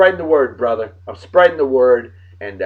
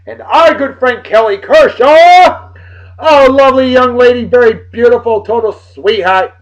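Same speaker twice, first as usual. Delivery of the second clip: hyped shout-out; 9 semitones higher, 8 dB louder than usual. Real, not acted. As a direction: sing their praises at full voice. Big shout-out to a good friend.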